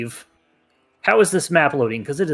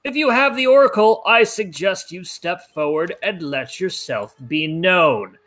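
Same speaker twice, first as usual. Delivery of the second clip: slow, high, loud menacing decree; booming villain voice; 5 semitones higher, 4 dB louder than usual. Acted. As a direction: announce the threat like a warlord. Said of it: "booming voice".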